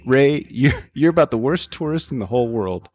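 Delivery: a hint of an exasperated travel companion; Ken from In Bruges. Host as Ken telling Ray off, lightly acted. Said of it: "This is Ken and Ray talking".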